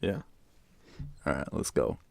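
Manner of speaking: ordinary delivery, nothing marked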